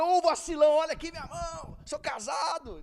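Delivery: high, loud indignant comeback; irritated retort. Retold or imitated. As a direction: re-enact yelling back at hecklers, exasperated. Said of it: "Aí eu fiquei tão irritado". (resposta irritada, em voz alta)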